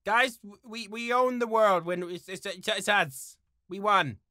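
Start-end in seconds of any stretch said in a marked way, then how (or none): none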